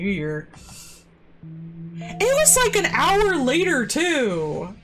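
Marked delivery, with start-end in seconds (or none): 2.0-4.8 high, exasperated emphasis; outraged at the timing; indignant. Real, not acted. Indignant retelling.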